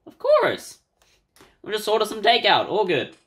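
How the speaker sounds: high, bright reassurance; reassuring boyfriend. Acted. Masato comforting his girlfriend, lightly reassuring.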